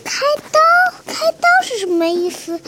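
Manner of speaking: 开刀 jumps up high in surprise; doctor asking puzzled, voice leaping high; 医生惊讶地问; surprised question. Acted. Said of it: "医生阿姨说".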